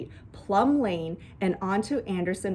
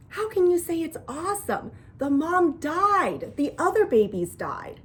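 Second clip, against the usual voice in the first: put-on higher, upset child's reproach; indignant scolding; 7 semitones higher than usual. A narrator's reading. Janie scolding Bat over dead skunks, indignant. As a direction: protest in dismay, pitched up. Sister scolds brother for saying awesome.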